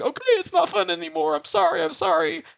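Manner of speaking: put-on high, fast apologetic child voice; high pleading little girl voice; scared little girl. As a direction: back down like a frightened kid. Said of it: "And the girl's like".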